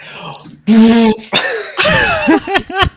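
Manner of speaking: high-pitched cartoon brain blast sound; mock revelation noise, way up high. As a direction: burst out with a loud, high whooping gasp. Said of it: "making brain blast noises".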